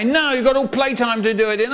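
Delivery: high, rapid refusal; strict teacher. Acted. Teacher refusing the whiny kid, strict. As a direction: rattle it off as a scolding teacher. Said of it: "And she'd say".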